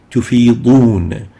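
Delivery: tufidun said slowly with a heavy emphatic d; emphatic dad. Shown as a demonstration. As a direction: tufidun drawn out, the d pulled back in the throat. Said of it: "in this word".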